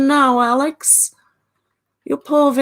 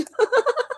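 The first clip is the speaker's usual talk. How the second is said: high giggle. Real, not acted. Laughs at her own remark.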